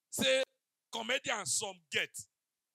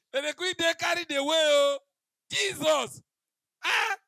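high and loud, rising to a shout; excited shouting; 9 semitones higher, 12 dB louder than usual. Real, not acted. Comedian telling the crowd the climax, excited shout. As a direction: burst out with it, crying Jesus.

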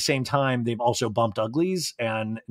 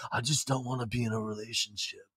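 much softer, averted mumble; evasive aside; 3 semitones lower, 10 dB quieter than usual. Retold or imitated. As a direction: say it very quietly, avoiding the listener. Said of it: "he looks to the side when he says it".

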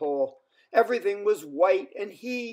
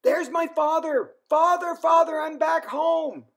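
high, loud excited shout; calling out joyfully; 11 semitones higher, 4 dB louder than usual. A narrator's reading. Lars calls to his father from the whale, high joyful shout. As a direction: shout it across the ice to Dad. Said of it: "Lars called".

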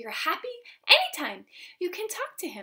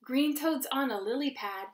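ordinary delivery

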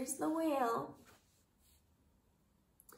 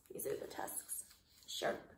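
whispered pointing out; soft whispered aside; 13 dB quieter than usual. Real, not acted. Hushed explanation to child.

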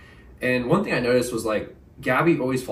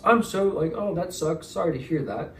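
higher, breezy fake sympathy; mock corporate PR; 6 semitones higher than usual. Mocking hotel staff's canned reply, a fake-sympathetic voice. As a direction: parody a hollow customer-service voice. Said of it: "the same corporate P R response".

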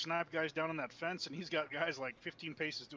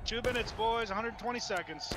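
shouting orders over battle; 6 semitones higher, slower than usual. Real, not acted. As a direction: call the time across the field. Calling time to the squad.

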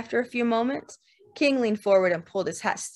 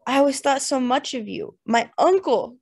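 higher, mildly wailing declaration; loud unhappy wail; 6 semitones higher, 5 dB louder than usual. A narrator's reading. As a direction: wail it out with drunken sorrow. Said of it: "cried King in a loud, unhappy wail".

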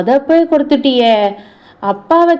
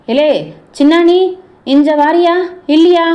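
high, raised summoning call; raised, demanding holler; 5 semitones higher than usual. Acted. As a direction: call a child over insistently. Calls the neighbour boy over.

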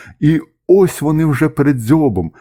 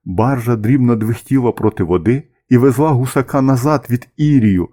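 usual speaking voice

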